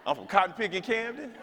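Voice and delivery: high, slow hammed-up hometown boast; mock brag. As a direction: puff it up like a New York brag. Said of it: "What in the world am I supposed to say?".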